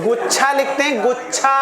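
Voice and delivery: normal talking voice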